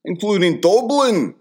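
high, loud comic Irish; Dublin accent. Shown as a demonstration. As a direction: burst into an exaggerated Dublin accent. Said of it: "talking in that accent".

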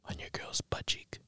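hushed whisper for the reveal; dramatic whisper. Pretend. Host revealing the photo to the boyfriend, whispered. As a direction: drop to a whisper after a pause.

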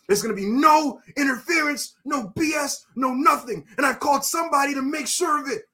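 high, building wrestler's declaration; impassioned promo. Acted. Fan imagining Cody calling out his enforcer, impassioned.

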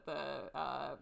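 usual speaking voice

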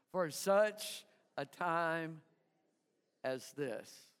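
"for such a time as this" drawn out slowly, fading low and soft at the end; slow call and response. Shown as a demonstration. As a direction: lead the crowd slowly, letting "as this" sink low. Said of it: "everybody say it with me".

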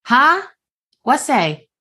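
loud, high startled huh; confused yelp over headphones. Acted. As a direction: jolt up, caught off guard. Gim pulls headphone out, confused.

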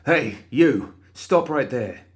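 alarmed guard's loud command; guard shouts. Acted. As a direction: shout him down. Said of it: "the guard cried".

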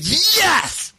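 high, drawn-out exultant cry; hammed-up jubilant yes; elated triumph. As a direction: celebrate as if he just got lucky. Elated at girl's invitation.

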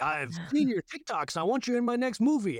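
high, eager director voice; imitating Spielberg. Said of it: "that Spielberg call to be like".

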